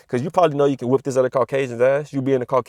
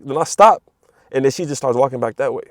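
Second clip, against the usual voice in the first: normal talking voice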